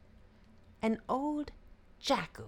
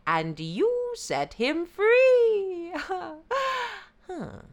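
very high yippy jackal voice; feigned confusion, excited; sly yippy jackal; 11 semitones higher, 5 dB louder than usual. Acted.

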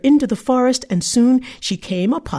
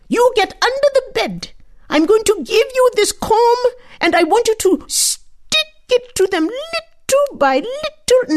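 high, otherworldly crone voice giving orders; old forest mother; 12 semitones higher than usual. Acted. The old woman telling the girl to hide under the bed, high and eerie. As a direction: instructs her in a strange high voice.

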